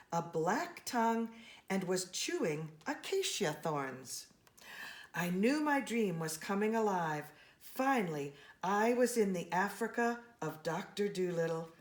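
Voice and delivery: plain reading voice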